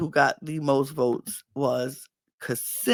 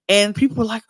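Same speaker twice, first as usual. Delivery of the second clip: ordinary talk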